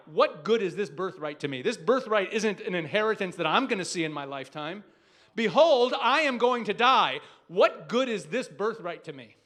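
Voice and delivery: loud, forceful brother protesting; Esau's blunt protest. Retold or imitated. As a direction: belt it out as Esau pushing back. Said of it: "And Esau says to him".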